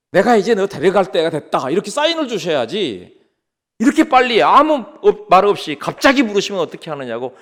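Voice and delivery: mildly acted angry protest; indignant young man. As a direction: argue with God over the missing warning. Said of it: "막 화를 내는 거예요". (따지듯 살짝 화난 말투)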